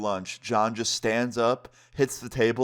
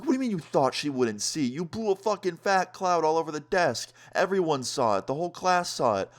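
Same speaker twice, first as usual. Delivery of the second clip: higher-pitched, incredulous retort; exasperated disbelief; 7 semitones higher than usual. Retold or imitated. Student to friend who thought the teacher wouldn't see, exasperated. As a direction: scold a friend for a ridiculous excuse, pitch up. Scolding friend's foolish excuse.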